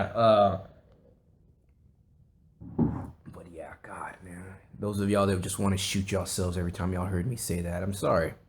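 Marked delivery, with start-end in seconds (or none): 2.8-4.4 whispered "but yeah", unvoiced. Shown as a demonstration.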